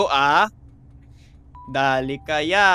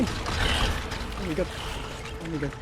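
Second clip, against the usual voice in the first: whispering; 5 dB quieter, much slower than usual. Real, not acted. Tense hiding moment.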